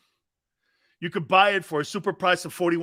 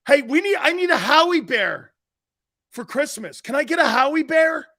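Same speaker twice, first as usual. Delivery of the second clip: put-on higher, louder, like a kid wanting a toy; mock kid begging; 8 semitones higher, 6 dB louder than usual. A fan begging for a Howie bear, hammed-up whiny kid. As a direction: beg for the bear like a child.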